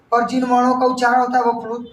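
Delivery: ordinary talk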